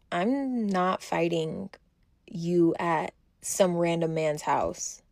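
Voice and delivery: slow, measured, word by word; deliberate refusal. Retold or imitated. Answering the rival's challenge to fight, slow deliberate refusal. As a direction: refuse slowly and firmly.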